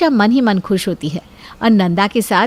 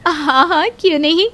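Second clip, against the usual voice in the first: eager; 7 semitones higher than usual. Acted. Nanda accepts eagerly.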